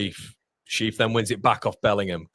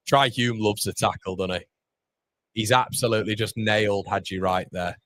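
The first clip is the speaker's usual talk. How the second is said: ordinary talk, nothing marked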